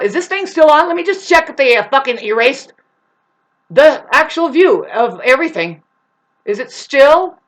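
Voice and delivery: loud and high, pressing every word; loud checking. Real, not acted. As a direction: call out to test if it's on. Checks whether recording still runs.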